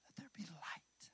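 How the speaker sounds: hushed unvoiced whisper. Shown as a demonstration. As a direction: breathe the words as a secret. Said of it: "what if it was just".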